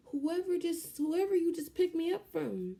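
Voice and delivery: loud high retort. Retold or imitated. Answering the nurse who asks who did it, loud and exasperated.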